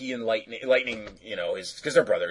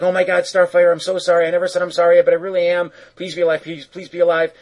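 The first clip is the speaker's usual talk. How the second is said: high, urgent apology on one pitch; mock frantic pleading; 7 semitones higher, 4 dB louder, faster than usual. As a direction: imitate someone desperately begging. Voices Beast Boy begging crushed Starfire.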